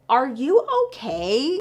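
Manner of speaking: staring at the photographer, disbelieving; incredulous. Retold or imitated. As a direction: drag it out like he's lost it.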